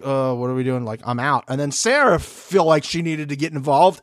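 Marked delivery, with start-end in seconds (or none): 0.0-1.4 lower, offhand imitated voice; retold resigned husband. Kiki's husband asking what they're doing, lightly mocked low and resigned. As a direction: imitate him bowing out in a lowered voice.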